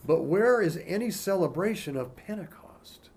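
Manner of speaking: normal talking voice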